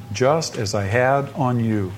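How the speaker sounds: "just as I had on you" slow and spaced out; measured, deliberate "just as I had on you"; pointed emphasis. Shown as a demonstration. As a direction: underline each word of "just as I had on you". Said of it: "underline those words".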